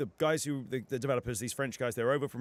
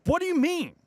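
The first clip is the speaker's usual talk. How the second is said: incredulous; 8 dB louder than usual. Retold or imitated. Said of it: "Just do a European accent".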